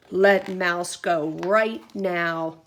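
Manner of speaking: slow, loud bear growl. Acted. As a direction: growl it as a command.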